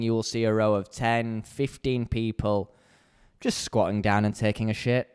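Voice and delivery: normal talking voice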